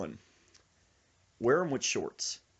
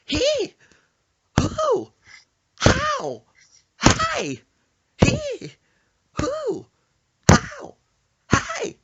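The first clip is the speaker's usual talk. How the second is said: very high, loud squeals, one by one; rhythmic frightened yelps; 14 dB louder, much slower than usual. Retold or imitated. Passenger jolted by every bump, rhythmic high yelps. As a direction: yelp on each bump, in rhythm. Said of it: "Sir Isaac goes".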